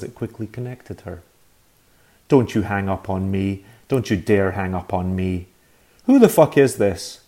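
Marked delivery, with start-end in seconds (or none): 2.3-5.5 louder, pressing threat; angry warning. Acted. Agnes threatening on redial. 6.1-7.2 higher, much louder bellow; furious shout. Acted. Man furious on the phone.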